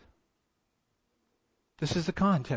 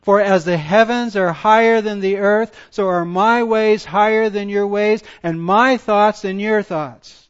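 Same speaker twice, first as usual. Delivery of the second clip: preaching at full force; 5 semitones higher, 8 dB louder than usual. Acted. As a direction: proclaim it to the back row. Preaches loudly to congregation.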